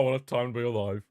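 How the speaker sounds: normal talking voice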